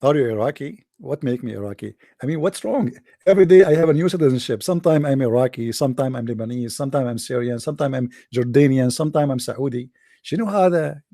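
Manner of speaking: ordinary talk, nothing marked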